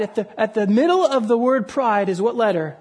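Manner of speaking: ordinary talk, nothing marked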